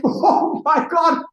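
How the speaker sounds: high, loud, drawn-out awe; mock amazement. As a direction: exclaim as if blown away, retold. Retelling amazement at Kelly's singing.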